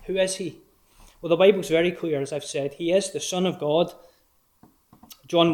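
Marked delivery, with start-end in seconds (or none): none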